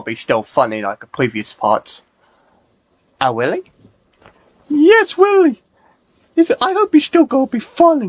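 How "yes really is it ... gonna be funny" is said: much higher squeaky toy voice; high character voice. Acted. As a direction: voice a little high-pitched friend.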